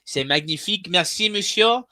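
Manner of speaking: hammed-up French accent, high pitched. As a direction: ham up a French waiter voice. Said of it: "I have a better French accent than him".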